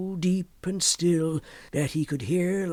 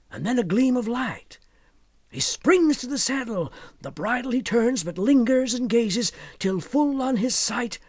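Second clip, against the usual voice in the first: excited; 6 semitones higher, 3 dB louder than usual. A narrator's reading. Lantern seen, he springs to ride.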